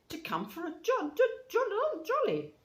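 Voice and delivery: high, stammering question; faltering. A narrator's reading. Toad notices his friends' stern looks, faltering. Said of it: "faltered and fell away".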